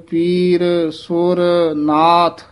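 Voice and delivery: ਸੁਰ ਨਾਥ said as two slow separate words; separated syllables. Shown as a demonstration. Teacher shows ਪੀਰ ਸੁਰ ਨਾਥ not run together. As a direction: split ਸੁਰ from ਨਾਥ, slowly. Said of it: "ਇਸ ਤਰ੍ਹਾਂ ਬੋਲਣਾ ਹੈ".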